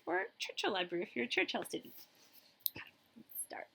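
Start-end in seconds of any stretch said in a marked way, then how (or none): 2.7-3.7 soft breathy whisper to begin. Real, not acted. Librarian whispering to the kids to begin. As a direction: lean in and whisper the start.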